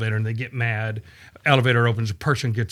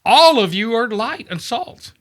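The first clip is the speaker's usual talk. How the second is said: higher, a touch louder, a hint of eager insisting; insistent reassurance; 7 semitones higher, 4 dB louder than usual. Jesus answering the doubting crowd, lightly eager insistence. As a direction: press the point warmly but firmly. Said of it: "And Jesus is like".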